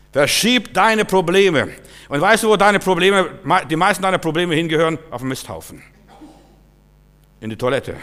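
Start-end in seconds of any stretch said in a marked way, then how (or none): 0.1-5.0 loud, high exhortation; forceful preaching. Real, not acted. Preacher tells listeners to move their problems, forceful preaching. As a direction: urge the congregation with raised voice.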